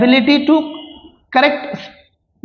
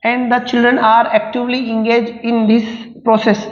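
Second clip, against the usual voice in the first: slow, deliberate dictation reading; reading exam options; much slower than usual. Acted. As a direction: read each word out slowly for students to follow. Reads question options aloud, slowly.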